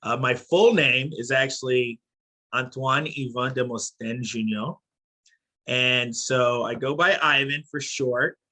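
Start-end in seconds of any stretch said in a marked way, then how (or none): 2.5-4.7 "Antoine Yvan Demosthène Junior" said with French vowels; French pronunciation. Shown as a demonstration. Guest teaching host his full name, "Antoine Yvan Demosthène Junior" in French pronunciation. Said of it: "I'll teach you how to say it".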